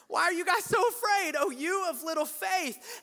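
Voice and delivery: high-pitched exasperated mock rebuke; mock exasperation. Jesus woken by frightened disciples, mock exasperation. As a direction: scold them in playful disbelief.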